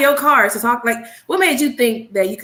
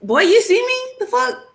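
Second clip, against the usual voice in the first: much higher, shocked disbelief; incredulous; 14 semitones higher than usual. Retold or imitated. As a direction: squeal it out like you can't believe his nerve. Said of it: "I looked at him like".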